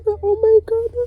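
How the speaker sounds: high, crying outburst then a long sigh. Real, not acted. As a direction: break into tears. Breaking down crying on camera.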